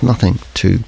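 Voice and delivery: ordinary talk, nothing marked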